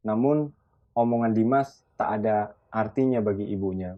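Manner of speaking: ordinary delivery, nothing marked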